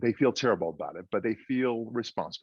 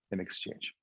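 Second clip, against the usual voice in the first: whispered aside; 3 semitones lower, 10 dB quieter than usual. Acted. Genie's bargain, echoed under breath.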